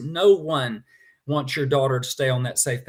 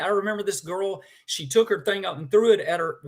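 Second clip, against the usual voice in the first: normal talking voice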